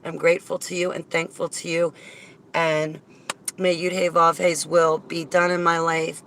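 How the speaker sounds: ordinary talk, nothing marked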